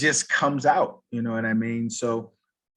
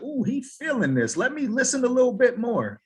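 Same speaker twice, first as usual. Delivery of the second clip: a much higher, eager inner voice; imitating hooked interest; 12 semitones higher, 3 dB louder than usual. Listener in a business talk getting hooked, eager and high. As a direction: lean in like the pitch just grabbed you.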